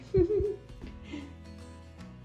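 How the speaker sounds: high chuckle tailing away. Real, not acted. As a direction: chuckle at your own little secret. Laughs about her hidden detail.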